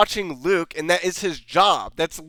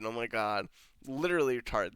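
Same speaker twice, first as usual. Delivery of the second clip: deflated; 6 semitones lower, 10 dB quieter, faster than usual. Real, not acted. Deflated muttered disgust.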